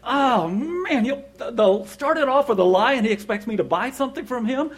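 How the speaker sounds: high, loud incredulous griping; worked-up mock outrage; indignant fuming. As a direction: rant to yourself in disbelief. Retold fuming after the call.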